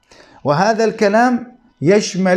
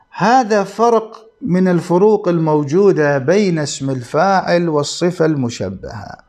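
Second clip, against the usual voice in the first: normal talking voice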